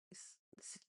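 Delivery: ordinary talk, nothing marked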